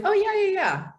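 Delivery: high, bright "oh yeah" of sudden recognition; mock sudden understanding. Waiters suddenly understanding partner.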